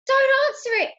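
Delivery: very high, loud urgent warning; panicked boy. A narrator's reading. Ben stops Granny opening the door, panicked. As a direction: squeal the warning in alarm.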